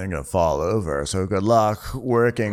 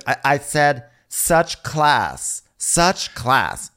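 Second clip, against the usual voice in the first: slow, higher, drawn-out mock disdain; snide repeat; 6 semitones higher, much slower than usual. [name] repeats his jab to Mikhail, snide. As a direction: stretch it out slowly and higher.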